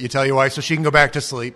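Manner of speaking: normal talking voice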